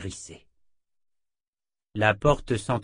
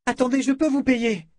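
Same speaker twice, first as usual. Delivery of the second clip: much higher, urgent call; urgent plea; 6 dB louder than usual. A narrator's reading. Jensen as the door closes, urgent plea. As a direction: call out to stop her leaving.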